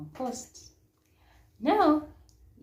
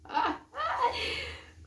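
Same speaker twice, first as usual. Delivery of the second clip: breathy high giggle; 4 dB louder than usual. Real, not acted. Laugh heard.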